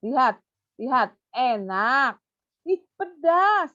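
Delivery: teacher naming tastes for a pupil; animated stimulation. Shown as a demonstration. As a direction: overplay the taste words. Demo verbal stimulation.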